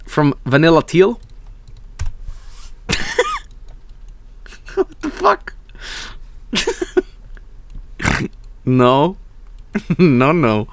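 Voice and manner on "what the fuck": high, stunned exclamation; real disbelief at the picture; laughing shock. Real, not acted. Shocked by fan art.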